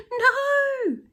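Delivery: high loud despairing cry; a falling boy's frightened no; cried. Acted. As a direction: wail it as the ground vanishes. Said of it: "he cried".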